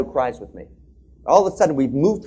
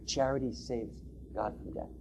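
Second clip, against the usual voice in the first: hushed repetition; 11 dB quieter than usual. Real, not acted. Speaker repeats the moral.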